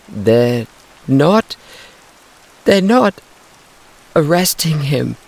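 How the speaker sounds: put-on high, loud distressed girl's cry. A narrator's reading. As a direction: cry out in disbelief. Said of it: "cried Paula".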